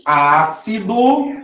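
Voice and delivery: Ácido drawn out, rising; Ácido slow with a rising end; slow demo. Shown as a demonstration. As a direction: stretch it as a prompt. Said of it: "repeated cue".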